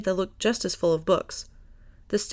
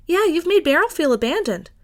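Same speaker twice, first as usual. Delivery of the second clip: teasing Millie; 9 semitones higher, 5 dB louder than usual. A narrator's reading.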